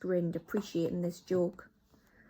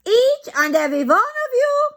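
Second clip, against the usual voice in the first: much higher, much louder witch boom; thundering; 15 dB louder than usual. Acted. Grand High Witch orders her witches, loud high boom. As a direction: command the whole hall. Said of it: "thundered the Grand High Witch".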